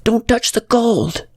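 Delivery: put-on high, slow, pleading child's cry; little girl's pleading shout. A narrator's reading. Little girl warning Adam in memory, high pleading cry. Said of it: "the pleading shout of the little girl".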